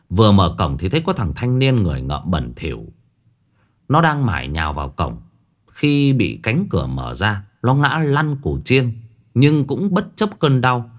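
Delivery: ordinary delivery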